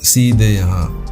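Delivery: usual speaking voice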